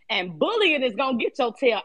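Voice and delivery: ordinary talk, nothing marked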